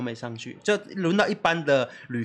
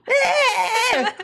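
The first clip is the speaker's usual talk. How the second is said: high loud drawn-out cry; mock toddler wail; 4 dB louder than usual. 模仿小孩哭喊. (高声假哭)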